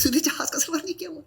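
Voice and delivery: high, quick, sneering jab; mock someone who never flew; contemptuous mockery. Host imitating friends mocking a first-time flyer, high and sneering. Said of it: "بڑی حقارت سے اور ہنسی مذاق کے طریقے سے".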